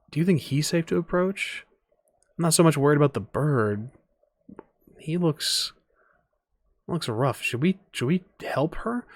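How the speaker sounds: lifted, hesitant bystander questions; wary onlookers. Acted. Bystanders asking each other about helping the girl, wary and hesitant. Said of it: "kinda watching, like".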